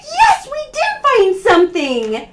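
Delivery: gasping, high and loud delight; a thrilled gasp of discovery; surprised. Pretend. Finding the hidden bear.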